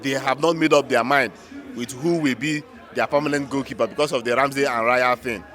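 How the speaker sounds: ordinary talk, nothing marked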